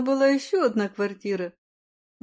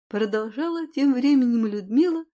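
plain reading voice

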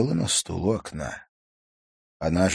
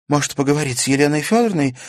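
alarmed; 8 semitones higher, 7 dB louder, faster than usual. A narrator's reading. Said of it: "встревожилась Карина".

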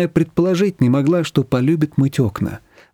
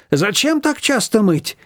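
higher, slow, bewildered; puzzled mother-in-law; 8 semitones higher, slower than usual. A narrator's reading. Mother-in-law questioning Nina, puzzled. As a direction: wonder aloud with reproach. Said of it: "недоумевала свекровь".

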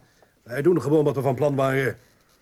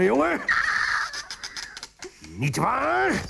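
insistent demand; 12 semitones higher, slower than usual. Acted. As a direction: force the boy to agree. Father pressing his son on rack.

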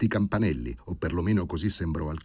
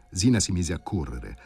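usual speaking voice

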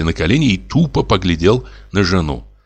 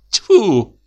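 a sharp, higher, louder Тьфу!; surprised spit; 12 semitones higher, 3 dB louder than usual. Acted. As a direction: spit it out in startled disgust. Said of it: "удивилась Араиса".

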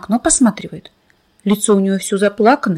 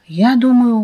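usual speaking voice

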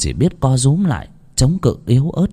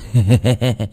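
brief mocking chuckle; sneering laugh. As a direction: laugh at him with contempt. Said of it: "nham nhở". (tiếng cười nham nhở)

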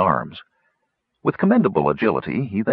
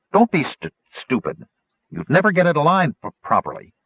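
stuttering scold; 6 semitones higher than usual. Acted. Stutz stammering, scolding.

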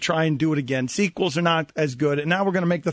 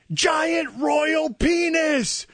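slow drawn-out shout, pitched high; 10 semitones higher, much slower than usual. Retold or imitated. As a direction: yell it slowly across a parking lot. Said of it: "what they shout at me".

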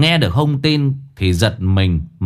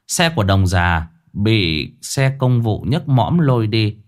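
ordinary delivery, nothing marked

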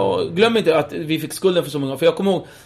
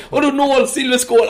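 dad mangling proverb; 9 semitones higher, 3 dB louder than usual. Retold or imitated. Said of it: "han fattar ju inte allting".

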